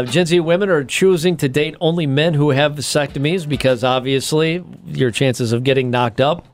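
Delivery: ordinary talk, nothing marked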